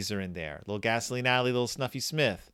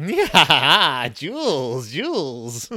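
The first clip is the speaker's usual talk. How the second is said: greedy Lilith; 7 dB louder, much slower than usual. Retold or imitated.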